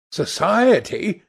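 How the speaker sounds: ordinary delivery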